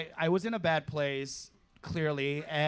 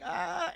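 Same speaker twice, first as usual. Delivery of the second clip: high yelp. Retold or imitated. Reacting to daddy request.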